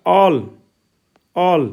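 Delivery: "all" with a long open आ, like "aal"; teacher showing the आ sound in "all"; vowel as Hindi आ. Shown as a demonstration. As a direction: model "all" alone, drawn out and falling. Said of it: "ये भी आ की ध्वनि में उच्चारण है".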